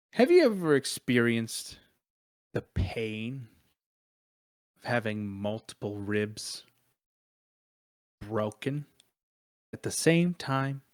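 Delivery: understated menacing build-up; dramatic grievance. Acted. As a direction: dwell on the injury with long pauses. Dramatic recounting of old injury.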